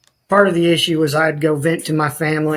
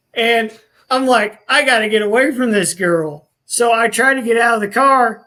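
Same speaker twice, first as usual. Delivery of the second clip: agitated; 7 semitones higher than usual. Real, not acted. Retelling a frantic fight in the van.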